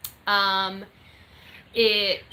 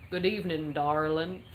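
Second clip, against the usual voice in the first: American accent; 5 semitones lower than usual. Shown as a demonstration. Streamer showing viewers she can do an American accent, drawled American. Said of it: "Can I do an American accent?".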